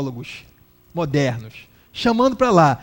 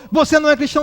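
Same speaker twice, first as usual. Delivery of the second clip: higher, louder mock accusation; retold shouting; 7 semitones higher, 6 dB louder, much faster than usual. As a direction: imitate a pastor yelling at someone. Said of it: "começou a gritar".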